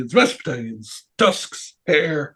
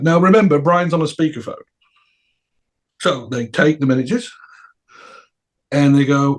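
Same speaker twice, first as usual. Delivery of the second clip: usual speaking voice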